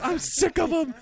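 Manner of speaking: very high mock tantrum squeal; imitated freak-out. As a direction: imitate a little boy losing it. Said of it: "and he freaks out".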